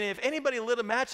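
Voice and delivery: ordinary delivery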